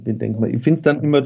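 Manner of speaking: usual speaking voice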